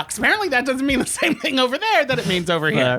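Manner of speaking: ordinary talk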